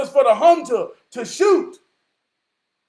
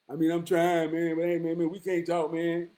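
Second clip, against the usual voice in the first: low, soft, dejected mumble; low, defeated brother; 6 semitones lower, 9 dB quieter than usual. Retold or imitated. Voicing a struggling brother telling him it ain't enough, dejected and low. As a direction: sound worn down and unappreciated. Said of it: "I've talked to some brothers who were slipping".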